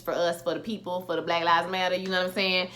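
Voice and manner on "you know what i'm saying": usual speaking voice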